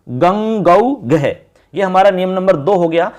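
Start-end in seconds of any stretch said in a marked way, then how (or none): 0.1-1.5 गंग गौ घे said piece by piece, very slowly; very slow, spelled out. Shown as a demonstration. Teacher splitting the word for students, very slow. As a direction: break गंग गौ घे into its parts, unhurried. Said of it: "इस तरह से देखो".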